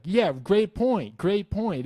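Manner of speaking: high, slow mock praise; sarcastic. Answering a troll's comment with high, slow sarcasm. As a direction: drip sarcasm on great point.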